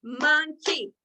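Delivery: Monkey split into two loud clapped beats; clapped syllables. Shown as a demonstration. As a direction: clap along, MON-KEY. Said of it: "Let's clap it together".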